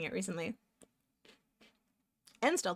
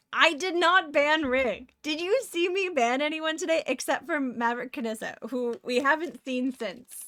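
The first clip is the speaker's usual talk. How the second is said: indignant protest; 6 semitones higher, 7 dB louder than usual. Real, not acted. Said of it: "denying ban to chat".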